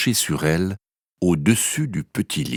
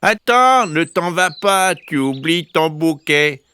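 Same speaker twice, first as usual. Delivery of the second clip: much higher, louder mama bear call; middle bear calling; 9 semitones higher, 3 dB louder than usual. Acted. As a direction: call after her like a kind bear. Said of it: "de sa moyenne voix".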